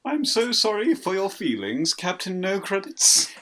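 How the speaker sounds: high snooty sneering jerk; mock him like a spoiled rich kid; posh bastard voice. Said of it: "we picked the right voice".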